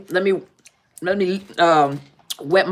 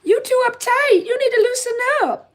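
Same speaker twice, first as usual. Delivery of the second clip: much higher made-up voice for a scolding friend; made-up Tasha voice. Retold or imitated. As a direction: squeak it way up like a nagging old friend. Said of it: "I just had to make up a voice".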